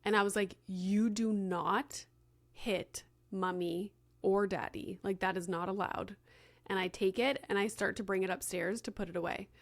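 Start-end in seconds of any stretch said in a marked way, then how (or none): 0.7-6.2 measured, retold parental reprimand; stern parent. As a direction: space out each word to lay down a firm rule. Retold scolding after being hit.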